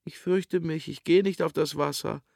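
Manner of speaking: high-pitched fearful whine; frightened boy's high wail, acted; whining little boy. A narrator's reading. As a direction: whimper a refusal like a small child. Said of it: "jammerte ein kleiner, schmächtiger Bub".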